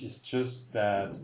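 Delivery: ordinary talk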